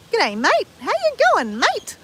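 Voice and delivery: broad Ocker Australian accent. Shown as a demonstration. Showing viewers an over-the-top Ocker Australian accent. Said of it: "That's not my normal accent".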